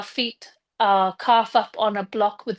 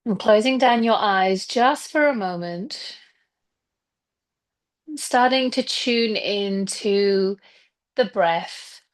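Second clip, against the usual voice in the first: guided meditation. Acted. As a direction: ease them gently into stillness, leaving space between phrases. Leads a breathing and visualization meditation.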